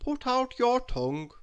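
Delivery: bossy little doctor; doctor's instruction. A narrator's reading. Doctor Makewell tells the patient, brisk doctor voice. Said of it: "he said".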